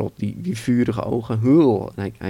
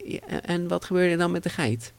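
put-on curious child; 8 semitones higher, 3 dB quieter than usual. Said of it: "mensen zeggen van".

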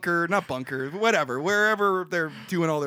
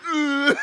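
exasperated ugh; mock disgusted groan; 9 semitones higher, 4 dB louder than usual. Mock disgusted groan in retold film.

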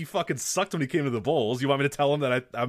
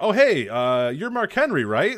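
high, louder, half laughing question; sheepish fan blurting it out; awkward fan approach; 6 semitones higher, 6 dB louder, slower than usual. Pretend. Fan approaching a wrestler in a restaurant, sheepish and high.

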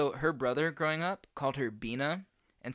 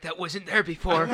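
spooked; 4 semitones higher, 7 dB louder than usual. Retold or imitated. Spooked friend quoted.

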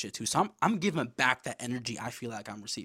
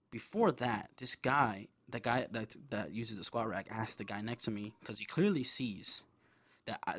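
ordinary delivery

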